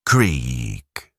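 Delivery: plain reading voice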